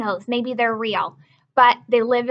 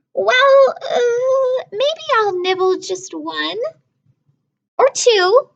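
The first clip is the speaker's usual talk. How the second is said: put-on higher, hesitant girlish voice; reluctant little sister; 12 semitones higher, 5 dB louder, much slower than usual. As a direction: waver like a tempted little girl. Lola changes her mind.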